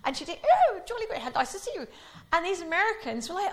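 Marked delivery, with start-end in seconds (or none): 0.4-1.9 jolly good said high and loud; hammed-up royal greeting; Queen impression. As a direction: trill it like the Queen. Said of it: "received English".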